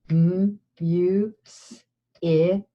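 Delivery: M, U, S, I, K, each sound split apart; sounding out words. Shown as a demonstration. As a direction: segment music into M, U, S, I, K. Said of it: "Let's do it again".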